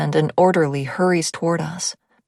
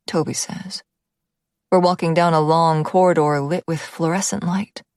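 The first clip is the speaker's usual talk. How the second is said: ordinary delivery, nothing marked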